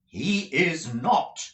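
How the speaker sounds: slow, firm, angry denial; angry retort. Acted. Nicholas angrily contradicting the schoolmaster, slow and firm. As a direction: dig in and refuse him, slowly. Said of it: "retorted Nicholas angrily".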